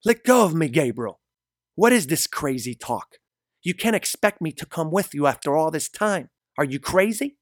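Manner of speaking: high, loud, indignant woman; angry shouting. A narrator's reading. Mari hits Gabriel's arms as he pulls her, high angry shouts. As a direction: shout in protest as you struggle free. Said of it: "She starts hitting his arms and shouts".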